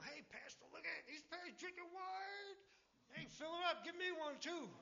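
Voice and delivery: high, excited voice of a gleeful churchgoer who spots the pastor drinking; mocking church member. Church member cheering on the pastor's wine, in a high mock voice. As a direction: pitch it way up, like catching him red-handed. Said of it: "someone in my church, they see me drinking".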